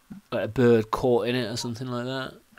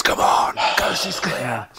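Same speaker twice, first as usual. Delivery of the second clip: loud, comically careless holler; mock shout; 4 semitones higher, 8 dB louder than usual. Teasing a player waving the party across, mock shouting. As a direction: shout it out as if stealth didn't matter. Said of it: "He shouts".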